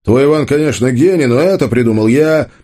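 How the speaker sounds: high-pitched, heated insistence; flaring anger. A narrator's reading. Tolik claims credit over Ivan, angrily. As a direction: insist hotly, pitch well up. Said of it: "вдруг снова взбеленился Толик".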